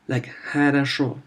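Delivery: хорошо with reduced first O, ha-ra-SHO; unstressed O like a short A in хорошо; хорошо по-русски; Russian pronunciation. Shown as a demonstration. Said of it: "It's like a mix".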